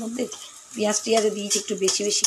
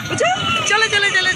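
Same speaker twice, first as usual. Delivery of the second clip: higher, louder eager call of চলো; 9 semitones higher, 3 dB louder than usual. Real, not acted. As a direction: urge the little one to hurry, চলো চলো চলো called out high and loud. Urging the child to come along.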